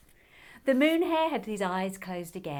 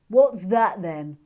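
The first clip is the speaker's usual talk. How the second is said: slow, loud dragon question; pointing dragon; 3 semitones higher, 13 dB louder, slower than usual. Acted. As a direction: call out, pointing. Sandra dragon voice.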